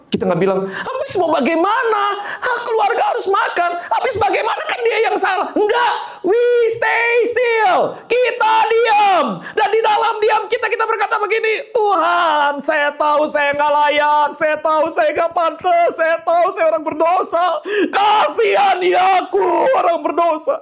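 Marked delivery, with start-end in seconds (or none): 0.6-5.5 high, strained self-justifying shout; hammed-up high-pitched complaint; protesting excuse-maker. Acts out people making excuses. 5.5-11.7 high, shouted exhortation; slow, drawn-out high-pitched sermon cry; impassioned preaching. Real, not acted. Preaches at full force. 11.7-20.5 high, slow pleading cry; anguished, drawn-out shouted prayer; impassioned confession. Acted. Acts out a sinner's plea.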